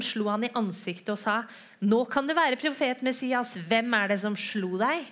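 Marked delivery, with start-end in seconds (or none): 1.8-5.0 higher, louder jeering challenge; mocking taunt. Guards taunting the beaten Jesus, jeering. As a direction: sneer at him like a cruel guard.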